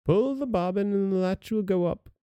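grandmother crying out. Acted. Ill grandmother calling from bed, mild high cry. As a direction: lift it high, a mild call to the door. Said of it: "cried out".

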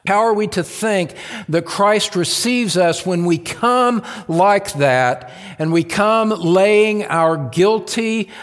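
fervent sermon voice building to a shout; preaching at full force. Acted. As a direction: preach it out to the back row.

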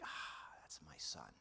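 soft, breathy whispered pride; proud hushed aside. Retold or imitated. Deacon dad whispering proudly as his son chants. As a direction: sigh it out like a proud father.

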